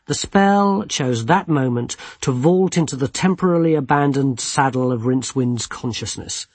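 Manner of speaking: ordinary delivery, nothing marked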